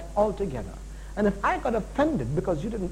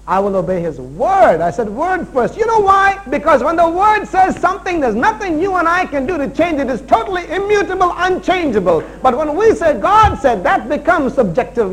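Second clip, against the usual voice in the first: loud, higher, driving sermon delivery; full-force preaching; 9 semitones higher, 8 dB louder than usual. Real, not acted. Preacher insisting to the church on the written word, full-force preaching. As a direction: drive the word home at full voice.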